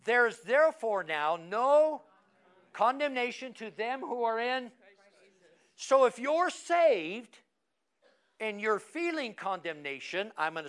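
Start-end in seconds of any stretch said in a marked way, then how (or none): 0.0-4.7 recited scripture. Shown as a demonstration. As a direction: read the verse aloud together. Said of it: "Say it with me as I go".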